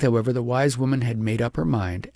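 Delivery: ordinary delivery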